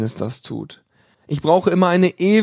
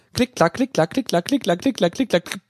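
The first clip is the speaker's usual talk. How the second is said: clicking noises; 4 semitones higher than usual. A narrator's reading. Imitating switch clicks.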